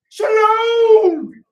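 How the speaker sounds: long, high, full-volume holler; joyful hollering. Acted. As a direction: belt out the greeting, overjoyed. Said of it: "Why you hollering at me?".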